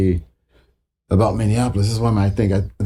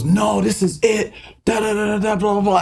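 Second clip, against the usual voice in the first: dismissive high McLean; 10 semitones higher than usual. Retold or imitated. Producer brushing off the musician, high and dismissive. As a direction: wave him off, pitched up. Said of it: "He goes".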